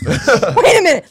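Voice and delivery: ordinary talk, nothing marked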